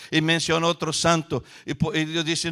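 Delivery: ordinary delivery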